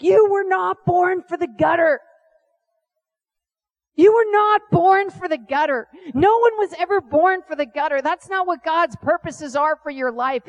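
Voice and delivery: put-on preacher-style shouted rebuke of a drunk friend; furious rebuke. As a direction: yell at him to get up out of the gutter. Scolding drunk friend in gutter.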